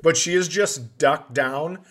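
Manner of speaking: ordinary talk, nothing marked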